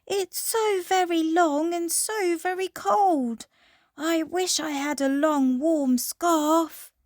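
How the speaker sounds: high animal character voice; giraffe voice. Acted. Giraffe speaks.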